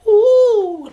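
a squeaky falsetto ooh, loud and laughing; high-pitched ooh. Retold or imitated. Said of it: "giving little high pitch".

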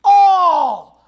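slow, loud crying shout; preaching cry. Acted. Preacher rereading the psalm in a loud, slow cry. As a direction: wail it out, dragging each word. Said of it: "Let me say that again".

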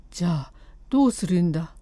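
low, quick, gruff husband's comeback; 1 husband's retort. Acted. Husband losing patience with his wife, low quick retort. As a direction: snap back at her, low and fast.

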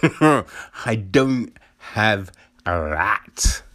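I don't have that, drawn out very slowly through a laugh; emphatic slow-motion denial with a laugh; slow, laughing insistence. Shown as a demonstration. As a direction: stretch every word of "I don't have that" while chuckling. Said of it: "Just so it's clear".

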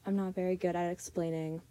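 ordinary delivery

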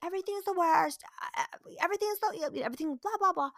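high, whining mock tantrum; dramatic child. Mom mimics her oldest's catchphrase, melodramatic complaint. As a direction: exaggerate a kid's melodramatic griping. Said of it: "My oldest thing is".

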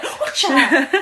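mock martial arts yelp; hammed-up kung fu shout; karate cry. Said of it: "My reflexes would be like".

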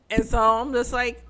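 normal talking voice

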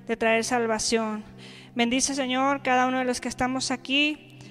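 quiet, soft-spoken prayer; hushed congregational prayer. Acted. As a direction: pray it gently and reverently to the Lord.

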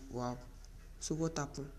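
quiet inner sarcasm. Retold or imitated. In her head reacting to husband's praise, quiet and dry. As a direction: think it under your breath. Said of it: "in my head, I'm like".

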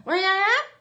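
high, loud, muffled call through a mask; mask lady imitation. A lady in a mask asks where your mask is, muffled high call. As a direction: squeak it out like words lost behind a mask. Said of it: "That's what she said".

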